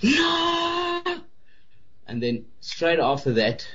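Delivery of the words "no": high screamed No. Retold or imitated. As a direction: scream it at the top of your lungs. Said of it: "I screamed at the top of my lungs".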